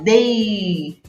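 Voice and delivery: DEI drawn out as one syllable; sílaba isolada. Shown as a demonstration. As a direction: hold DEI long for the pupils. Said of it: "depois eu falo". (DEI bem alongado, numa sílaba só)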